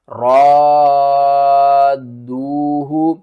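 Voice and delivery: teacher demonstrating madd lazim; long madd demo. Shown as a demonstration. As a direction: drag out the vowel of را slowly. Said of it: "ہجے کر کے سمجھاتا ہوں".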